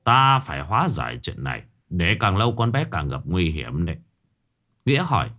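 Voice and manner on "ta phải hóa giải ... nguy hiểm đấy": stepfather gently asking his daughter about the ghost; grave stepfather. Acted. Stepfather Hải speaks.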